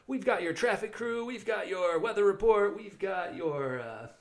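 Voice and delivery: morning radio DJ. Pretend. Morning radio host bit.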